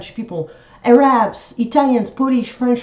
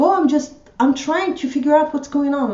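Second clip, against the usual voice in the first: higher-pitched imitation of a lightly flustered worker; retold excuse; 6 semitones higher than usual. Imitating the postal woman hiding on the phone, higher-pitched excuse. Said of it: "she was like".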